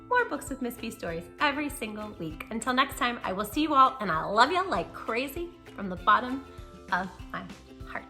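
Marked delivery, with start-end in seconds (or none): none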